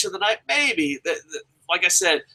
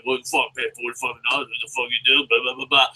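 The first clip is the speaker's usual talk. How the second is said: muttered mock grumpiness; grumpy grumbling; 3 semitones lower, faster than usual. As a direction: grumble like a cranky old fan. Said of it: "grumpy McGillicuddy was like".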